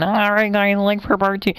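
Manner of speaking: high, mocking YouTuber voice; Kermit the Frog voice. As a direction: parody a clickbait YouTuber. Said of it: "sounded like Kermit the Frog".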